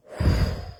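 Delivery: long unvoiced sigh of frustration; weary sigh. Real, not acted. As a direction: let out a tired sigh. Frustrated with email troubles.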